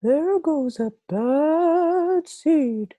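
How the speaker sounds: high, slow, put-on voice of others gossiping; mock the whispering neighbours, drawn out; slow, high snide gossip voice; mumbled gossip. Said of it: "they mumble".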